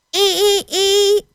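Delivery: high-pitched imitated creak; squeaking gate. A narrator's reading. Gate creaks as Martha opens it, high squeak. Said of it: "klonk het weer".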